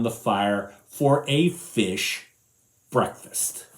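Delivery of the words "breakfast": ordinary delivery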